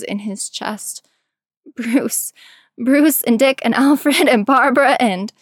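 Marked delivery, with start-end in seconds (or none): 1.8-5.3 louder, higher, urgent listing; desperate pleading. A narrator's reading. Tim lists family to stop Jason, pleading desperately. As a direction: pile up the names desperately until cut off.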